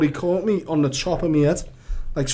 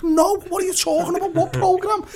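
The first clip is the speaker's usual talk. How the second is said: high, incredulous mock protest; baffled denial; 13 semitones higher than usual. Wrongly accused man answering the parents, high and baffled. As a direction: deny it in rising bewilderment.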